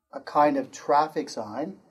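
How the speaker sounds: ordinary talk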